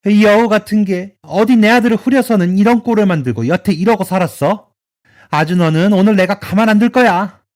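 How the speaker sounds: mother-in-law threatening her daughter-in-law in fury; venomous rage. Acted. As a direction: spit the insult out with hatred.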